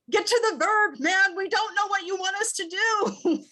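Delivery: high, pleading panicked plea; frantic. Retold or imitated. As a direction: urge him, desperate and high. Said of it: "everyone is just like".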